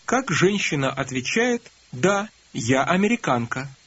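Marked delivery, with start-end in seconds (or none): none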